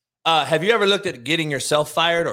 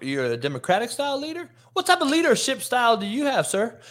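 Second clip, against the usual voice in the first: higher, fast, mock-pompous grilling; mocking interviewer voice; 7 semitones higher, faster than usual. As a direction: ham up a stuffy interviewer firing questions. Mocks the interview question asker.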